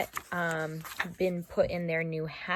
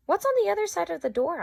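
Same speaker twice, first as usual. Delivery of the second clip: high, fast, excited little elephant voice; little girl Ruby; 12 semitones higher, 6 dB louder, much faster than usual. Acted. Young Ruby pestering Ivan with questions, high and eager. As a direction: bounce up high like an eager child. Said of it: "she'd be asking".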